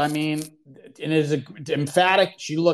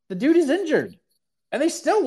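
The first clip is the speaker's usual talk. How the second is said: much higher and louder, incredulous; incredulous outburst; 12 semitones higher, 4 dB louder than usual. Real, not acted. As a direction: blurt it out in disbelief at the injury. Outrage at interim title.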